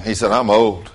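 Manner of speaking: normal talking voice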